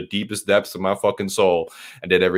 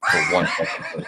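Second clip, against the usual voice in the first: laughing outburst; 4 semitones lower, faster than usual. Real, not acted. Laughing rant about accents.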